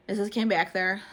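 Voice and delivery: ordinary delivery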